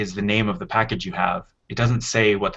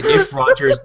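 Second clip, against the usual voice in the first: laughing; 6 dB louder than usual. Real, not acted. Laughing at friends' teasing.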